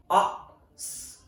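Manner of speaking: "ask" broken into "ah, s, k"; sound by sound. Shown as a demonstration. Teacher breaking down British "ask" into separate sounds. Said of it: "In British English".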